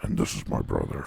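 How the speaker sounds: breathy, hushed creepy whisper. Retold or imitated. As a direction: breathe it like a creepy podcast host. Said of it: "Even the way they introduce each other".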